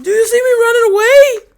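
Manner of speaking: high, loud whining call; mock wailing shout. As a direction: wail it after them, hammed-up. Someone calling while leaving.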